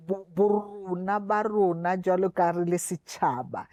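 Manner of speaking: usual speaking voice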